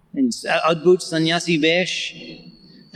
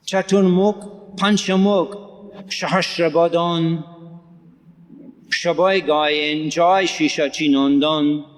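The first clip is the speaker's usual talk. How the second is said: slow careful reading; slower than usual. Shown as a demonstration. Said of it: "I'll read that again".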